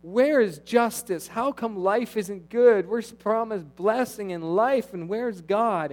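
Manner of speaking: mock tearful complaint of the people; pleading lament. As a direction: plead like people weeping at the altar. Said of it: "throw themselves on the altar with tears".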